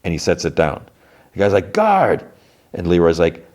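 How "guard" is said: calling out. Retold or imitated. Prisoner calling the guard at gunpoint, high shout. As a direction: yell for the guard.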